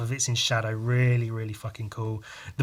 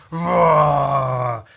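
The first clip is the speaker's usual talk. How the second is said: monster roar; 2 semitones higher, 8 dB louder than usual. Retold or imitated.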